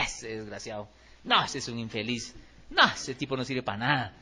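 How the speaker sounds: contemptuous muttering. Retold or imitated. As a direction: sneer the insult under your breath.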